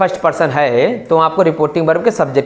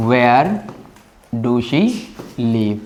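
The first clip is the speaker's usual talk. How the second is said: slow dictation; 4 semitones lower, 3 dB quieter, much slower than usual. Shown as a demonstration. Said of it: "यहाँ do लिखो".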